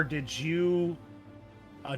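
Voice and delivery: usual speaking voice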